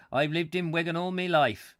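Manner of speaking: broad northern English local; hammed-up northern English dialect; northern English accent. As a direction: put on a rough-and-ready northern English accent. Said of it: "I'm probably killing the accent".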